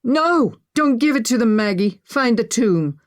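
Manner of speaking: high, loud shouted warning; desperate shout. A narrator's reading. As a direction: shout urgently from the background. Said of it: "Ollie shouted in the background".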